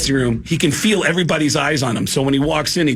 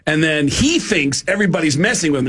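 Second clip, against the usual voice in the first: ordinary talk, nothing marked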